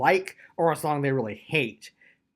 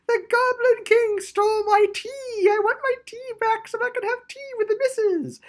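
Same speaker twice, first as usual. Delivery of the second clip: high, squeaky mock-posh whine, much higher; silly mock voice; 4 dB louder than usual. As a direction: parody a whiny player character wanting tea back. Mocking a dull player backstory.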